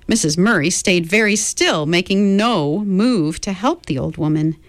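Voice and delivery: plain reading voice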